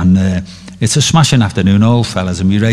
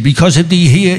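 higher Irish brogue; scheming Irishman, in an Irish accent; 6 semitones higher, 3 dB louder than usual. Retold or imitated. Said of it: "the Irish accent".

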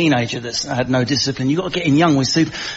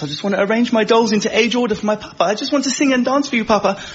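put-on high, sweet little-girl voice; little princess; 6 semitones higher than usual. Young daughter doting on her papa, sweet and high. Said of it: "dancing princess".

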